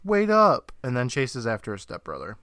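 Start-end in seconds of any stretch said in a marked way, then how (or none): 0.1-0.7 raised, higher calling-out voice; yelled call. Retold or imitated. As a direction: call out after someone getting away. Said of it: "and yells".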